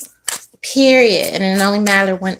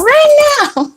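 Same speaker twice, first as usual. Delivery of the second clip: much higher and louder, near a shriek; mock yell; 6 dB louder than usual. You repeating your vow to stay mad, mock yelling.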